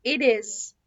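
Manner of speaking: "it is" run together as "idiz"; linked flap T. Shown as a demonstration. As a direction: say "it is" with the T tapped like a D. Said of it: "اسمها it is".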